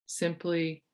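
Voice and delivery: soft, unhurried meditation guide voice; calm retreat leader guiding the breath; guided meditation. Acted. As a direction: ease them gently into stillness.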